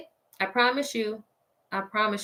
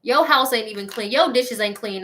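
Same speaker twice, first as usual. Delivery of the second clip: usual speaking voice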